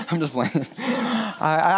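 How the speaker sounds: chuckling through it; giggly self-deprecating; laughing. Real, not acted. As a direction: laugh off the joke. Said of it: "I'm just kidding".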